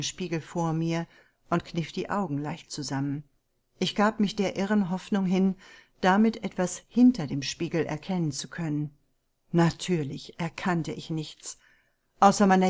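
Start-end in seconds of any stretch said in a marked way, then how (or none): none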